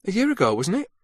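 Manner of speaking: put-on Lincolnshire accent. A narrator's reading. Kendall reminding Carter they met, Lincolnshire accent. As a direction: ask it lightly with Lincolnshire roots showing. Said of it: "her voice betraying her Lincolnshire roots".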